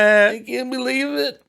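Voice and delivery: high, loud hammed-up disbelief; mock disbelief. Host joking about internet lies, hammed-up disbelief. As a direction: ham up being stunned.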